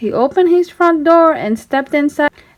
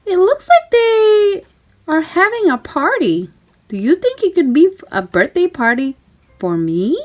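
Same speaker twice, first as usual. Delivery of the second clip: high Mickey voice; 6 semitones higher than usual. A narrator's reading. Mickey telling Pluto he hopes for a party, high hopeful mouse voice. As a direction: wonder aloud, hoping it's for you.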